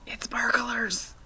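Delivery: slow, breathy frightened whisper; hissed whisper. A narrator's reading. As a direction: warn the family in a hush. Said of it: "hissed the mother".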